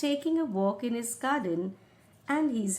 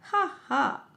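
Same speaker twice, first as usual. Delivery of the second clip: mocking laugh; 4 semitones higher, 4 dB louder than usual. King laughing.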